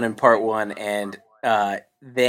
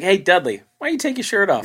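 mocking creepy adult; 8 semitones higher than usual. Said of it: "This is where he's like".

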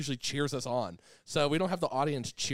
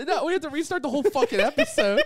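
laughing; 10 dB louder than usual. Real, not acted. Laughing hard at censored joke.

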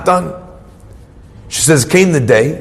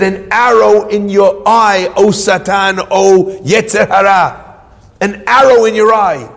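slow, raised-pitch cry of defiance; defiant rebuke; 4 semitones higher, slower than usual. Retold or imitated. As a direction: hurl it slowly at the enemy, drawing out the words. Husband defies Satan after banging table.

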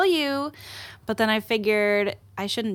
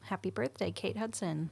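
subdued; 10 dB quieter than usual. Real, not acted. Echoed muted wish.

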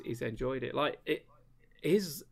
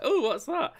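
surprised; 13 semitones higher, 6 dB louder than usual. Pretend. Imagined reaction to odd pick.

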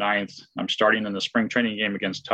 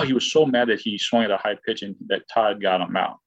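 normal talking voice

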